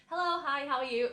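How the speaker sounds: bright, high put-on greeting; forced cheerful hello. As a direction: act breezy as if nothing is wrong.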